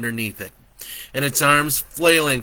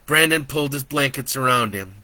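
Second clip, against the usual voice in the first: ordinary delivery, nothing marked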